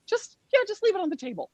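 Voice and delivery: high-pitched imitation of a nervous mom; hammed-up false casualness; mock flustered covering-up. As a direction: deflect in a squeaky, anxious voice. Acts out flustered mother covering up.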